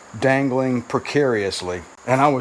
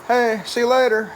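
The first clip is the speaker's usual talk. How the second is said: calling out; 10 semitones higher than usual. Real, not acted. Husband to wife going to work, calling out high. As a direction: call a goodbye over to someone leaving.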